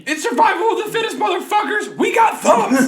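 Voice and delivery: high, loud rallying yell; boastful mock war cry; triumphant shout. Real, not acted. Boasting humans beat animals.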